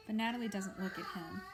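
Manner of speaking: usual speaking voice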